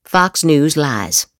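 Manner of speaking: ordinary delivery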